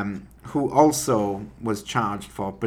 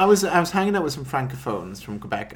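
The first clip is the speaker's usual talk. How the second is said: ordinary talk, nothing marked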